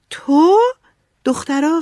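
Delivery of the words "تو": high, loud burst of astonishment; excited. A narrator's reading. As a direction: blurt it out, thrilled and much higher. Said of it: "مک با هیجان گفت". (با هیجان، بلند و زیر)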